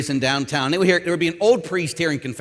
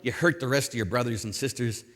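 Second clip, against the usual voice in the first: lightly voiced, lower and softer; a quieter, low-pitched aside as Jesus; Jesus's gentle reply; 8 semitones lower, 7 dB quieter than usual. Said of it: "But then he'll say".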